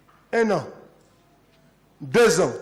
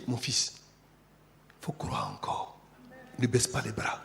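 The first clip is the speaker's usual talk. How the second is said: tender encouragement; 6 semitones lower, 9 dB quieter, slower than usual. Acted. Spirit speaking tenderly to believer.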